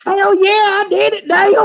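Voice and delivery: high, loud hammed-up victory holler; mock hometown winner whooping in triumph; Nigel, jubilant. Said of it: "He's like".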